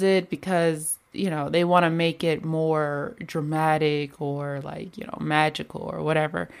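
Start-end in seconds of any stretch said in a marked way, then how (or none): none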